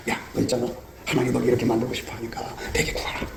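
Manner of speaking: low, hushed, half-whispered aside; confiding murmur. Acted. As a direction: lean in and pass on a secret quietly. Jesus confides God's plan to someone.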